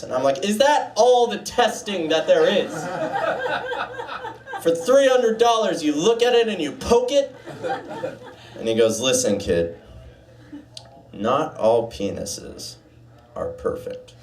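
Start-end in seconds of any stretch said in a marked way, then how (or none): 0.5-7.4 high, indignant disbelief; outraged patient asking about the price; incredulous complaint. Retold or imitated. His own indignant quote, high. 9.0-14.1 low, slow, patronizing doctor; doctor delivering the bad news; condescending doctor.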